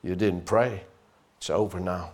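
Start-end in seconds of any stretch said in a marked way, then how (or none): 1.4-2.1 low, soft taunting; quietly mocking accuser; hint of a hushed taunting devil; devil's voice. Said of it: "that voice never comes from Jesus".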